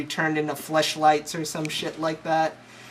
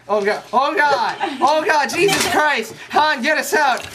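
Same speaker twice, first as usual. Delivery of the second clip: high, loud hammed-up panic; mock panic; 7 semitones higher, 3 dB louder than usual. Retelling the space worm escape, hammed-up panicked shrieking.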